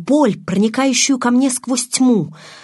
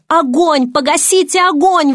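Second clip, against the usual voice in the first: high, loud cry of agony; frantic raised voice; screaming; 7 semitones higher, 6 dB louder than usual. A narrator's reading. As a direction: yell for help with fire. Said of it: "вопила я".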